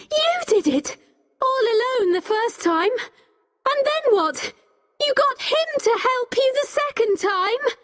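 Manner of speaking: high-pitched, outraged accusing questions; shouted accusation. A narrator's reading. Becky confronting the old man, shouting high. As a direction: hurl accusations in a high, heated voice. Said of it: "started shouting".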